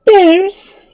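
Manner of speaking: high, loud alarmed cry; exclaiming. A narrator's reading. Girl hearing of bears, loud startled exclamation. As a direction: burst out in startled, girlish shock. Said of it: "exclaimed Dorothy".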